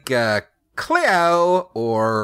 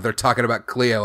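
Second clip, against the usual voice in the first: ordinary delivery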